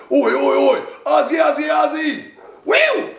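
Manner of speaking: high-pitched shouted fan chant; high, rowdy cheering chant; Aussie cheer. Pretend. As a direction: whoop it up like a sports crowd. Cheering chant with Australian flag.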